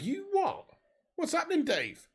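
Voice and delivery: high, incredulous you what; surprised. Real, not acted. Surprised reaction to chat.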